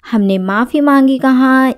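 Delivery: normal talking voice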